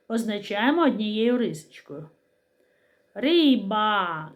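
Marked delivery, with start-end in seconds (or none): 3.1-4.3 Риба with the final А held long; final А emphasized. Shown as a demonstration. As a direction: stretch out the ending of Риба. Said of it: "Звук А".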